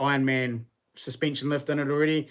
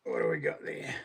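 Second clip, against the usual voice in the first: muttering; 8 dB quieter than usual. Real, not acted. Peering at phone.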